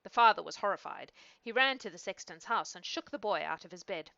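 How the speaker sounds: ordinary delivery, nothing marked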